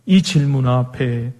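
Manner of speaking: normal talking voice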